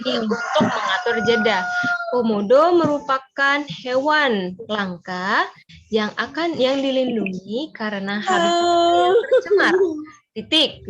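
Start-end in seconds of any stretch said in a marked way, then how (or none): 2.1-10.9 slow, with a pause at each break. Shown as a demonstration. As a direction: read it slowly, stopping at the punctuation. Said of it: "di situ mengatur jeda". (dibaca pelan dengan jeda di tanda baca)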